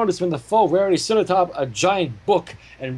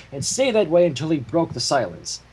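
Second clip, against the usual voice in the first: ordinary talk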